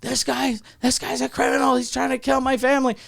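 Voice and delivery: high-pitched yell without the volume; high, held-back mock alarm cry. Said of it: "starts yelling".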